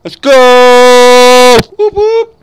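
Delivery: high, loud whooping cheer; excited hype shout. Real, not acted. Streamer whooping to his friends as the hunt starts, loud high excited cheer.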